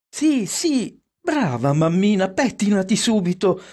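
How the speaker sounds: put-on high-pitched, eager child voices; excited approving children. A narrator's reading. Children urging mamma to do her hair, excited approval. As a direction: cheer her on like delighted children. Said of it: "approvarono i figlioli".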